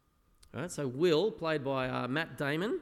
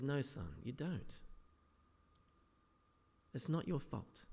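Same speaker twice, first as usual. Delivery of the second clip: softer, tender retelling of the therapist; gentle reassurance; 8 dB quieter than usual. Retold or imitated. Therapist gently telling Will it isn't his fault, softly tender. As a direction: coax him gently, like a father. Therapist comforting abused young man.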